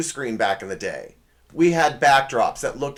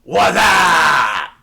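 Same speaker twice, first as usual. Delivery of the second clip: loud, higher mock commercial yell; shouted catchphrase; 5 semitones higher, 10 dB louder than usual. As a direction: holler it like a goofy ad catchphrase. Imitating the Wassup commercial catchphrase.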